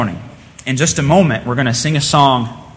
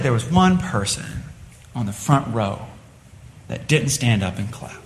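softer, lower, halting reply; subdued confession; 4 semitones lower, 6 dB quieter than usual. Acted. Crying pianist explains to the onlooker, subdued and halting. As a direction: explain it quietly, with pauses. Weeping girl explains her upset.

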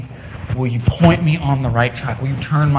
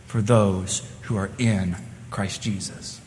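ordinary talk, nothing marked

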